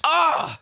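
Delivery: high "ah!" of frustration; short, high cry of exasperation; exasperated yell. Real, not acted. Vlogger fed up with holiday emails, exasperated yell.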